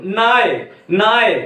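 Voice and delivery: "नाय" repeated, each ending on the glide; last syllable dropped. Shown as a demonstration. Teacher shows students the trick, "नाय" without its क. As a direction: say "नाय" as a separate word, no final क. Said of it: "का मत बोलो".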